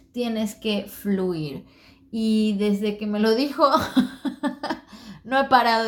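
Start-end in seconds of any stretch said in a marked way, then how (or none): 0.1-1.7 slow emphatic motto. Real, not acted. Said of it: "esta frase".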